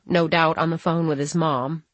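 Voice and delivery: usual speaking voice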